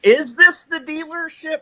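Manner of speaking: high-pitched, incredulous re-asking; mock bewildered phone query; baffled repeat question. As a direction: ask it high, as if nothing makes sense.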